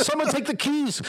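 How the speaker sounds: high-pitched mock plea; retold frantic plea. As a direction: act out a desperate plea, amused. Retold plea during game panic.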